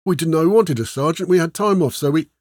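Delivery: high-pitched, overlapping excuses to a sergeant; blurted excuses. A narrator's reading. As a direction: blurt it out like flustered soldiers. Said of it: "we blurted over each other".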